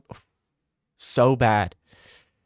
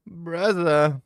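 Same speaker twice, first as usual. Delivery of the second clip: lightly voiced Dagestani accent, braza stretched; Islam impression; 4 semitones higher than usual. Streamer doing his best impression of the fighter Islam, Dagestani accent. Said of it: "that a good Islam impression?".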